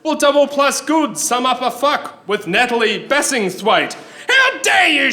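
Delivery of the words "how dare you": high, indignant shout; outraged shout. Acted. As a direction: explode with offended outrage. Outraged interrogator.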